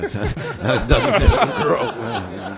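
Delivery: laughing, pitch jumping high. Real, not acted. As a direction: laugh through the words. Laughs over his own joke.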